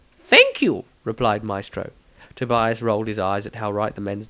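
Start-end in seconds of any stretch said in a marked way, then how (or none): 0.1-0.8 put-on high, excitable old wizard; eccentric wizard voice. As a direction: play a pompous, giddy wizard. Maestro replies.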